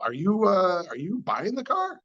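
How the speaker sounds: high, rising, incredulous question; pointed question. Retold or imitated. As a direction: needle him about whether he's even buying. Retold pointed question to buyer.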